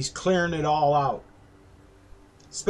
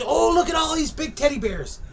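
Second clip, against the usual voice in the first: mock excited customer; 9 semitones higher than usual. Excited customer quote, high.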